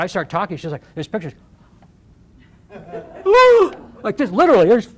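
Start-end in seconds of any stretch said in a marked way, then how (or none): 2.7-3.9 a loud, high gasp of sudden shock. Retold or imitated. Director watching the speech unravel, shocked gasp. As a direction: gasp like the floor just dropped away. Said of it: "It was like shock".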